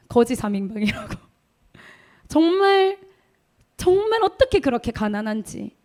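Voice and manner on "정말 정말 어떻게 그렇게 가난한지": high laugh breaking into the word. Real, not acted. 가난을 떠올리며 웃음. (웃음 섞인 높은 목소리로)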